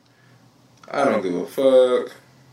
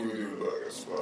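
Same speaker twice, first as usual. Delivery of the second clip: fast breathy whisper; teasing a hungover friend in a whisper; 5 semitones lower, 13 dB quieter, much faster than usual. Retold or imitated. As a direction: whisper it like you can barely lift your head. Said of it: "You probably would've been like".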